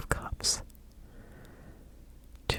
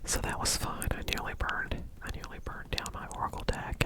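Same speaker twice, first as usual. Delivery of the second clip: ordinary talk, nothing marked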